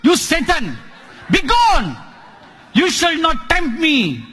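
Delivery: high, loud shout at Satan; rebuking shout. A narrator's reading. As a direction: cast him out, shouting. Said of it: "So he rebuked".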